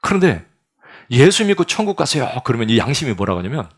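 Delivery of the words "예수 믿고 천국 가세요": loud and much higher, ringing cry; preacher shouting even harder from the pulpit; louder pulpit shout. Acted. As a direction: belt it out as hard as you can. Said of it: "이렇게 소리를 지르는 거야".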